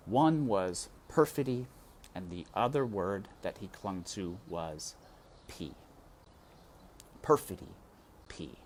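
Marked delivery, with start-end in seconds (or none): none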